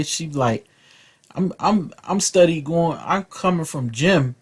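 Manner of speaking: usual speaking voice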